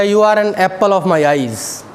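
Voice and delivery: very slow, deliberate compliment. Retold or imitated. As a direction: say it slowly as a fond compliment. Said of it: "मैंने एक लड़के को बोल दिया". (लड़के को धीरे-धीरे तारीफ़ करते हुए)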